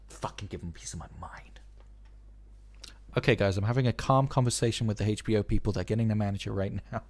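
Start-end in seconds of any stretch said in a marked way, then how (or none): 0.1-1.6 soft, fast whisper under the breath; whispered aside. Real, not acted. Streamer aside to chat during the call, whispered grumble. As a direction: hiss it so they can't hear.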